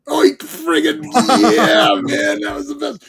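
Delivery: high, strained fanboy cheer; hammed-up elation. Acting out excited reaction to entrance.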